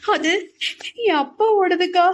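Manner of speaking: crying, voice breaking on father; shaking sobs. A narrator's reading. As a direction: force the words out through heaving sobs. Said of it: "குலுங்கி குலுங்கி அழுதாள்".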